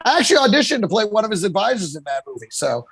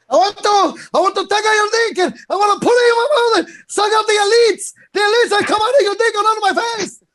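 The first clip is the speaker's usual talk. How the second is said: high-pitched mock foreign accent; hammed-up accent, pushed far up in pitch; Hector Lombard accent; 10 semitones higher, 3 dB louder than usual. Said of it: "Hector Lombard accent".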